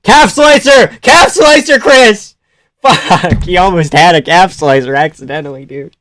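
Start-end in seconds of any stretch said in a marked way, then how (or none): 0.0-2.4 high, loud shouts at the fighter; real excited high-pitched shouting; urgent coaching yell. Real, not acted. Yelling at fighter during live watch.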